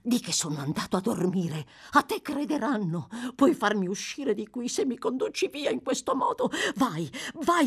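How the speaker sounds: a hint of a frantic old schemer; lightly voiced old man. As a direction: press the boy urgently.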